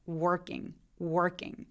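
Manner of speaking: "working" with a pulled-back r; r-colored working. Shown as a demonstration. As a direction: curl the r in "working". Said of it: "혀가 당겨져야만 합니다".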